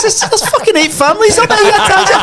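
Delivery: high and laughing, mock scolding; laughing correction. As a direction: set him straight through laughter.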